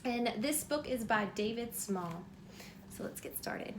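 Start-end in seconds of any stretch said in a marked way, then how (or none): 3.0-3.7 whispering. Real, not acted. Whispered aside before reading.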